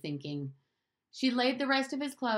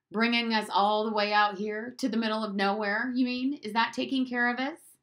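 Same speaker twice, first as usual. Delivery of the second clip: put-on sarcastic boy retort; 4 semitones higher, 5 dB louder than usual. Bruno retorts to Maria.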